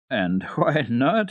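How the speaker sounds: slow, amused laugh through the words. Acted. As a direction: chuckle out the question warmly.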